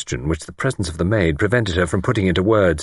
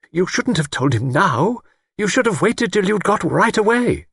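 high, urgent reproach; fretful spinster; 13 semitones higher than usual. A narrator's reading. Older woman blames couple for telling, fretful. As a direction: scold anxiously, pitch held high. Voices Miss Wainfleet.

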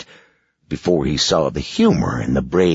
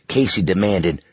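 ordinary delivery

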